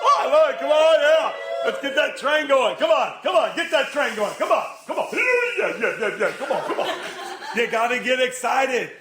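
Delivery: loud, high hype-man cheering; energy and excitement. Shown as a demonstration. Preacher urging the crowd to get the train going, excited cheering. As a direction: whoop the crowd into motion. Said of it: "I want some energy and excitement".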